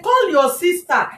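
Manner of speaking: slow, high-pitched exasperated order; retold anger. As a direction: re-enact an angry demand. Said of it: "I was so angry at a point".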